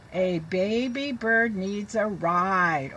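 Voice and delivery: very slow, careful read-aloud; teacher reading to children. Shown as a demonstration. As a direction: read it slowly for the class.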